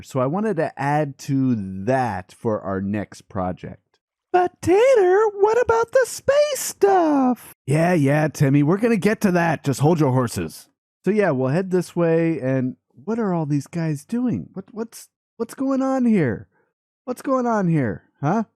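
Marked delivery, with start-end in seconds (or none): 4.3-7.5 put-on much higher, slow, whining kid shout; a pestering little kid calling out; whiny kid. Timmy nagging Tater about the space project, high whiny kid. 14.5-18.4 higher, puzzled what's-going-on; startled double-take; surprised. Real, not acted. Vlogger finding strange villagers in the market, higher surprised.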